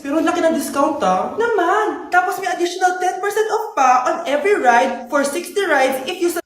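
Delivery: loud, upbeat sponsor pitch; punchy promo read. Acted. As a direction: sell the ride app cheerfully. Reads the sponsored Grab ad.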